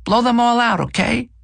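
put-on very high-pitched child; small child's high voice, rising on okay; kid's voice. A narrator's reading. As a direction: plead like a little kid. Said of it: "One kid pokes Eddie and says".

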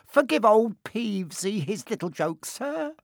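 grovel as a poltergeist; greasy grovelling. Acted. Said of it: "he said greasily".

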